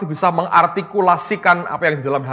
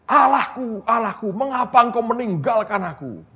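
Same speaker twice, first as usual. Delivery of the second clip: imitated cry; 8 semitones higher than usual. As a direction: act out an anguished call to God. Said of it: "Dia teriak sampai gitu loh".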